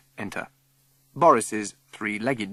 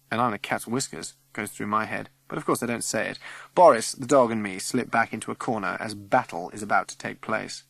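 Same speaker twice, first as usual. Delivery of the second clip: usual speaking voice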